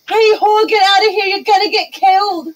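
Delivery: loud, high yelled warning; rough shout. Acted. Player character yelling at [name] to flee. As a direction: bark at her to get out. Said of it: "I'm gonna turn around and look at her".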